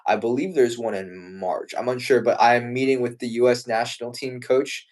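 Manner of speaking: usual speaking voice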